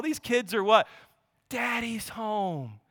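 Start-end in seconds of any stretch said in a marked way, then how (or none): 1.5-2.8 tender. Acted.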